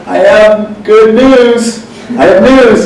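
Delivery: high, loud and slow, bursting with news; excited announcement. Retold or imitated. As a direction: tease out big news eagerly.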